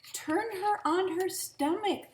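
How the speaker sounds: high, loud busybody neighbor; neighbors' advice. A narrator's reading. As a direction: offer advice like a fussy neighbor. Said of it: "they said".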